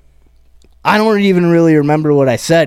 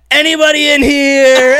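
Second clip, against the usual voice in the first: calling out to a crowd; 11 semitones higher, 4 dB louder than usual. Retold or imitated. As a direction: call it out high to the whole room. Addressing the comedy crowd onstage.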